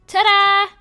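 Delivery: loud, high flourish; triumphant ta-da. Real, not acted. Player back up at last, triumphant ta-da. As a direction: show it off loudly and proudly.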